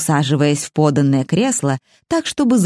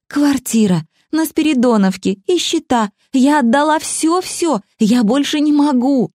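desperate pleading; 9 semitones higher than usual. A narrator's reading. Tasha pleading, exhausted.

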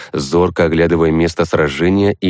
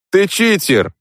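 smiling; 13 semitones higher, slower than usual. Acted. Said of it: "улыбнулся призыватель".

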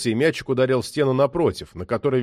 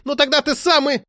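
high-pitched angry squawk; shrieked retort, pitch way up; screeching; 4 dB louder than usual. A narrator's reading. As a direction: screech over him down the phone. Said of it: "Трубка заверещала так".

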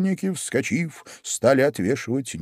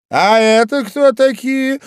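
high, loud, drawn-out demand; imperious queen; 12 semitones higher, 8 dB louder, slower than usual. A narrator's reading. Queen demands who the gardeners are, imperious. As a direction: demand it slowly like a haughty queen.

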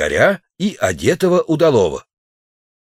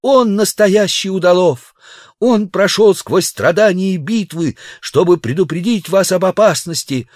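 passionate plea; 9 semitones higher than usual. Acted. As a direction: plead fiercely with the hall. Girl passionately defends the savage.

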